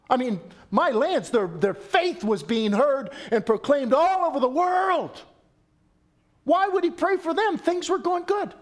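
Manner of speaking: high, loud disbelief; incredulous. Real, not acted. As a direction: ask it as if it makes no sense. Incredulous preaching question.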